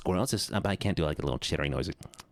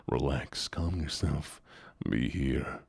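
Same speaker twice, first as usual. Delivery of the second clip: mock calming; 12 semitones lower, 4 dB quieter, slower than usual. Mock calming self-talk.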